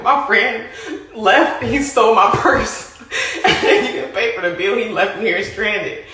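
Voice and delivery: laughing through the words; real laughter as she retells it. Real, not acted. Retelling being left at the restaurant, laughing. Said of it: "I'm in a restaurant crying".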